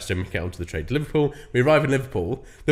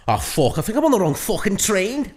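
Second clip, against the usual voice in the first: lightly put-on Scouse, higher pitch; Scouser imitation; 8 semitones higher than usual. Imitating the scouse guy on the train, light Scouse. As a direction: do a light Liverpool accent. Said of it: "this guy just goes".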